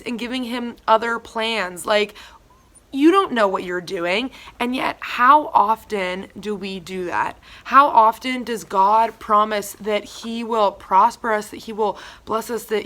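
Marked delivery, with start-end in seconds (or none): none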